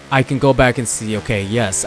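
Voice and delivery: ordinary talk, nothing marked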